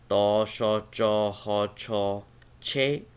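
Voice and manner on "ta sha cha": ordinary talk, nothing marked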